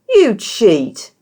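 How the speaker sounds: loud, high indignant cry; furious accusation. A narrator's reading. Berna accusing Isha of rigging the bet, furious. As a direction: hurl the accusation at her. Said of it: "regarding her furiously".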